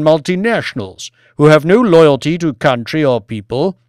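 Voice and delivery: loud, forceful harangue; demanding lord. Acted. Lord demands Banks look after the people, loud harangue. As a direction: drive each point home loudly.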